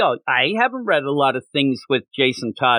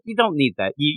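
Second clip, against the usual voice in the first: ordinary delivery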